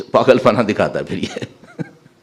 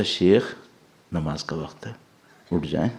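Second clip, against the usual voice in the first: put-on softer, lower, gentle call like a mother waking a child; loving wake-up; 7 semitones lower, 9 dB quieter than usual. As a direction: coax him awake tenderly and quietly. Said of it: "اتنے پیار سے اٹھا، جیسے ماں".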